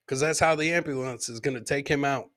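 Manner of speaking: usual speaking voice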